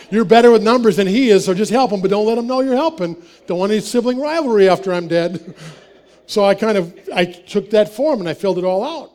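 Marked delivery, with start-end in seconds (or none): 0.1-5.5 chuckling as you quote mom; amused, laughing mom's advice; laughing retelling. Retold or imitated. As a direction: voice it through laughter, louder. Mom's words voiced while laughing.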